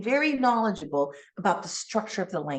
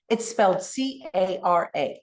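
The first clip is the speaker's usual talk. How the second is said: ordinary talk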